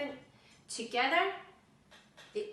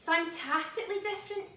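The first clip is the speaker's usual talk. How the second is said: amazed delight; 7 semitones higher than usual. Acted. Meg amazed by wings.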